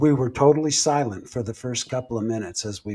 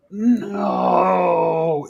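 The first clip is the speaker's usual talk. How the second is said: drawn-out wailing "no", higher than usual; unwomanly possessed voice; 5 semitones higher than usual. Retold or imitated. Possessed woman during a missionary blessing, unwomanly wail. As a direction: imitate a possessed wail, long and held. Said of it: "in this voice that did not belong to a woman".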